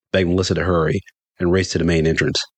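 ordinary delivery, nothing marked

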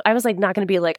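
ordinary talk

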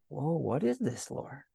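soft, lightly acted amazement, trailing away; quiet wonder. Mary asking the Lord about the shepherds, quietly amazed. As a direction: ask it in hushed, mild awe.

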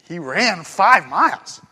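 slow matter-of-fact read; he ran five miles, just a fact; plain statement. Shown as a demonstration. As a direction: state it flatly, unhurried. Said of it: "You see the difference?".

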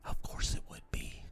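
very soft, voiceless muttered aside; whispered aside. Real, not acted. Comic reacting to the man's name, whispered aside. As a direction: whisper it to yourself.

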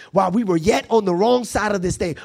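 high, rhythmic sermon cadence; impassioned preaching. Real, not acted. Preacher builds a repeated refrain.

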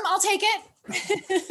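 delighted, laughing acceptance of the compliment; a slow, pleased, giggly I'll take it; laughing, drawn out. Real, not acted.

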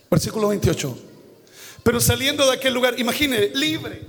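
ordinary talk

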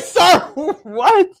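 high, loud "what?" through laughter; a hammed-up, stunned "sir, what?"; mock bewildered cry with a laugh; laughing disbelief. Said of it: "And it's like".

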